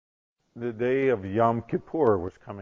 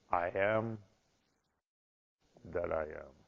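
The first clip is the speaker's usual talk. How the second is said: low, hushed divine answer; voice of God; 7 semitones lower, 10 dB quieter than usual. Acted. God answering in the synagogue, low and solemn. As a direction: speak softly and low as God.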